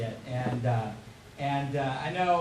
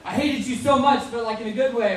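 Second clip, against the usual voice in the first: raised, louder mock exclamation from a fan; gushing fan quote; 5 semitones higher, 7 dB louder than usual. Audience member to actor after the play, gushing. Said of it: "they'd be like".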